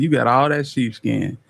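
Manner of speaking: ordinary talk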